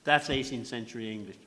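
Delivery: normal talking voice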